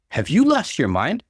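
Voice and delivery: mild shocked outburst; exclaiming. A narrator's reading. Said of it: "I exclaimed".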